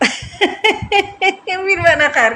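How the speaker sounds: high, loud, slow words through laughter; laughing. Real, not acted. Vlogger slips on खैर while recording, laughing as she speaks. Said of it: "मुझे इतनी हंसी आ रही है".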